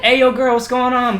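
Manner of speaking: high, loud imitation of a pushy suitor; hammed-up catcall to a girl; mock come-on. Voices strangers messaging her.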